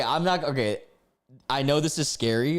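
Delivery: ordinary delivery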